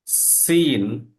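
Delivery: সিন with a long drawn hiss; letter সিন demo. Shown as a demonstration. Teacher tells students to watch, stretching সিন. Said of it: "পরবর্তী বর্ণ হচ্ছে আমাদের সিন".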